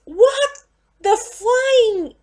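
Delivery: high, loud, slow curse through a laugh; laughing outrage. Real, not acted. Fan half laughing, half yelling at the film. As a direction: laugh out the disbelief.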